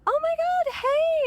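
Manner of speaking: very high, delighted squeal of recognition; excited greeting. Retold or imitated. Greeting a stranger she mistook for a friend, high excited squeal. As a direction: gush hello like to an old friend. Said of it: "So I go".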